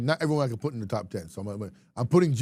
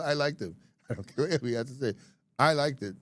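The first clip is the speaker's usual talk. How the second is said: ordinary delivery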